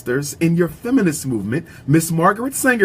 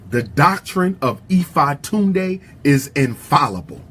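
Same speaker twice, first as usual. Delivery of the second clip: usual speaking voice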